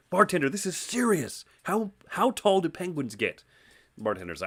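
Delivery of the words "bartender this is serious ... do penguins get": high-pitched pleading insistence; hammed-up urgency, pitched high; urgently serious. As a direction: act a desperate man demanding an answer. Guy insists again in joke.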